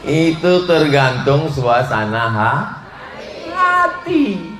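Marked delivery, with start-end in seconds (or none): 3.5-4.5 hati leaps very high and falls; sing-song hati. Acted. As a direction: stretch hati up high like a tease. Stretched punch word.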